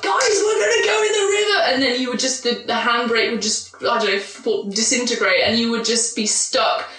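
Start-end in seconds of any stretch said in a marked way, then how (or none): 0.0-1.6 tour guide hype. Retold or imitated. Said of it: "you're like".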